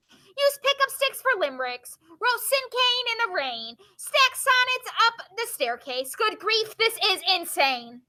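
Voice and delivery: poem reading. Acted. Teacher reads a poem to the class, high rhythmic poem reading. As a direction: lift it high and beat out each line.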